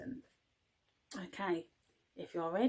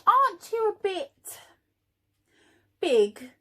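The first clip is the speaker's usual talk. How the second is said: high, loud, piping bee voice; clever little bee; 11 semitones higher, 9 dB louder than usual. Acted. Amelia the bee questioning Norman, high piping voice. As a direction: pipe up like a curious little bee. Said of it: "asked Amelia".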